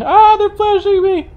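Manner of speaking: very high, slow, loud mock wail; hammed-up wail. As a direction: stretch it into a squealing fake lament. Hamming up the headlight complaint.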